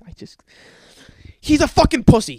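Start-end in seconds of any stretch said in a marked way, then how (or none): 1.4-2.4 angry outburst. Real, not acted. Angry rant about the player.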